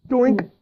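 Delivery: a cartoon bat-hit sound; silly imitated bonk; cartoon bonk noise.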